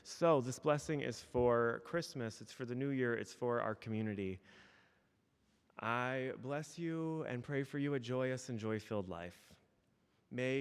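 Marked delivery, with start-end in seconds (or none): none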